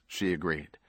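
ordinary delivery

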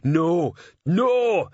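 high, slow troll shouting; troll yell. A narrator's reading. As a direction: yell it drawn out as a troll cop. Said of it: "yelled Detritus".

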